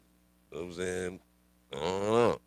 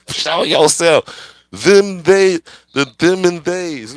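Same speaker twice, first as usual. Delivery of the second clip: higher and louder, slowing on the them and theys; mock challenge; 8 semitones higher, 14 dB louder, slower than usual. Taunting the unseen "them", loud mock challenge. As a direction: call out the unseen like a ghost hunter.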